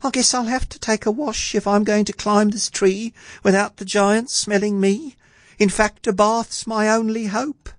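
high, musing lad's voice; murmuring boy. A narrator's reading. Jack deciding he needs a wash, murmuring boy. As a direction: think aloud as a little boy. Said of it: "He murmured softly".

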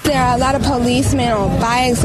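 ordinary delivery

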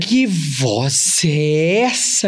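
very slow, drawn-out marvel; amazed echo. Real, not acted. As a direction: stretch every word, stunned by the voice. Echoing, amazed at his voice.

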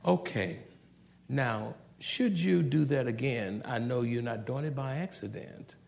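quiet, firm, with a smile; calm but firm. Retold or imitated. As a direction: parent warning a child softly, calm threat. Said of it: "calm and mean business".

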